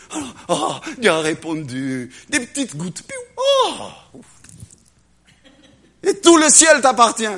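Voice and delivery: ordinary talk, nothing marked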